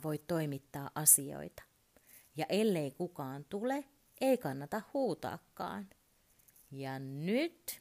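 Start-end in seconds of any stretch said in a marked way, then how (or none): none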